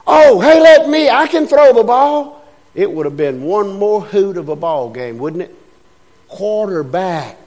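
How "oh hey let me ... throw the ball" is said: higher, louder eager call; eager volunteer. Retold or imitated. A backup player volunteering eagerly. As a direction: jump in eager to play. Quarterbacks quit, volunteer calls out.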